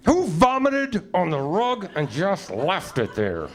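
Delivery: high, slow mock-scolding voice talking to the cat. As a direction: nag the cat in a shrill put-on voice. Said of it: "talking out loud at home to my cat".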